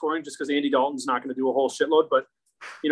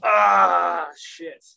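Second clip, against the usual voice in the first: anguished groan; 2 semitones higher, 4 dB louder than usual. Real, not acted. Agonizing over a tough pick.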